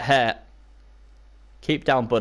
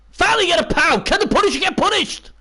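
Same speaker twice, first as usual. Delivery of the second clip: high, loud and thrilled; cheering the hit at last; excited; 4 dB louder than usual. Real, not acted. A long-awaited hit lands.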